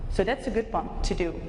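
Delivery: normal talking voice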